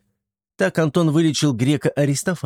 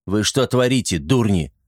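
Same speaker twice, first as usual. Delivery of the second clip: ordinary delivery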